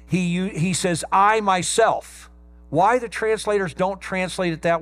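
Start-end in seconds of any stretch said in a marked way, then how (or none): 1.1-2.3 "I myself" drawn out slowly and pushed a little louder; emphatic I myself. Shown as a demonstration. Preacher pointing out the Greek emphasis, slow and pressed. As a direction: lean hard on "I myself". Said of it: "It's a double emphatic".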